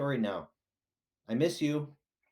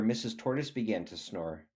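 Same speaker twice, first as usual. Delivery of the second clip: plain reading voice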